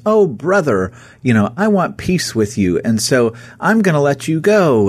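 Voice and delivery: high, gushing fake warmth; hammed-up pal to an enemy; mock friendly Ahab. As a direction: lay the brotherly charm on thick. Ahab retold sparing Ben-Hadad.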